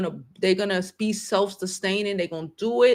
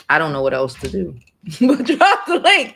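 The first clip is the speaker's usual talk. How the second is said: louder, with a laugh breaking in; 7 dB louder than usual. Real, not acted. Vlogger laughing at dropping the donation link. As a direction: laugh through the helplessness.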